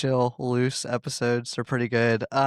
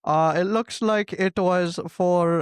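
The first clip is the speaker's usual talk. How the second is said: put-on higher Indian-accented call center voice; Indian telemarketer accent; 4 semitones higher, slower than usual. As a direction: play an Indian phone scammer. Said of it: "Indian telemarketer".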